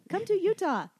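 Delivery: high, loud tourism plug; mock PSA. Host plugging Utah to listeners, mock PSA. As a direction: pitch it up like a playful public service announcement. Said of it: "our little P S A for Utah".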